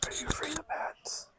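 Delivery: hushed question; whispering. Real, not acted. Whispering in a cave.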